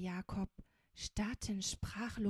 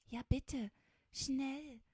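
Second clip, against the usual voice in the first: higher, mildly impatient begging; a touch of impatience, pitched up; impatient plea; 5 semitones higher, 3 dB louder, slower than usual. A narrator's reading. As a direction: beg them to hurry. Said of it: "bat Fingerspitzchen ungeduldig".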